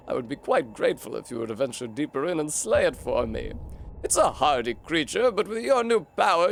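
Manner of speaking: a kindly-sounding but scheming mystic offering a gift; British snail shaman. Acted. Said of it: "I'm British, apparently".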